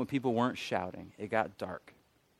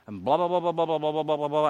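rapid babble on a raised pitch; running my mouth; 4 semitones higher, 5 dB louder than usual. Retold or imitated. Speaker mimicking his own mental rant to God, nonstop babble. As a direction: rattle it off in one higher stream. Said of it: "I'm running my mouth to God".

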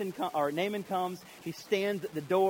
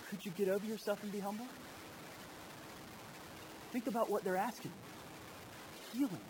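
soft rebuke; 4 semitones higher, 10 dB quieter than usual. Acted. Gentle rebuke aside.

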